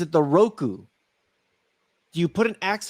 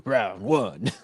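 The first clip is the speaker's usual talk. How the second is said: fight announcer; 10 semitones lower than usual. Pretend. Announcer-style fight call.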